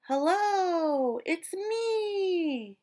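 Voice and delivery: high bright call to friends. A narrator's reading. Small fish calling to his friends. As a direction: call out hopefully across the reef. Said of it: "he called to his friends".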